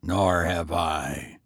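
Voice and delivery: low, slow, calm reply. A narrator's reading. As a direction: say it calmly, unbothered. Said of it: "the tramp said calmly".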